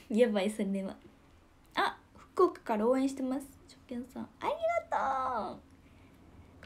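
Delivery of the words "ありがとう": very high, stretched thank-you; a sudden high squeal of thanks; squeaky thanks. Real, not acted. Thanking a first-time viewer, squeaky high.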